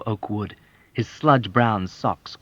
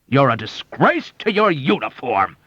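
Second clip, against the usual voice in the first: louder, higher wrathful bluster; 6 semitones higher, 7 dB louder than usual. Acted. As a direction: rage at the captain pompously. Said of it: "declared with blustering wrath".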